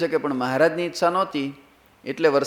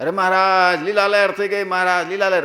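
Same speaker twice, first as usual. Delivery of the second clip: high-pitched, jubilant villagers' cry; acted delight; 6 semitones higher, 5 dB louder than usual. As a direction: gush to the Maharaj as overjoyed farmers, hammed up. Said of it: "રાજી રાજી થઈ ગયા". (હરખઘેલા દરબારોની નકલ)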